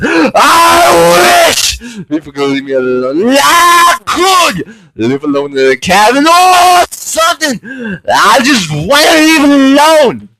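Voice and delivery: hammed-up self-pity; theatrical sobbing plea; mock wailing.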